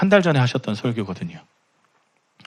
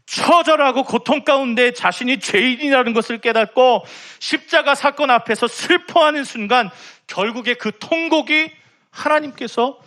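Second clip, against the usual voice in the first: fervent preaching; 10 semitones higher than usual. Acted. Pastor urges the congregation toward repentance, fervent preaching. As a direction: preach it with rising fervor. Preaches on weeping before God.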